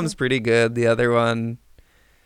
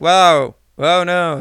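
mock alarm; 4 semitones higher, 9 dB louder, much slower than usual. Acts characters hitting a rock.

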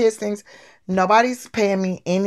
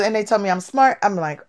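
ordinary talk, nothing marked

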